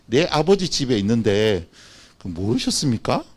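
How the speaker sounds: low, soft, measured answer; calm reply. Acted. Young Jesus to his parents in the temple, calm. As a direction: answer the scolding gently, unhurried.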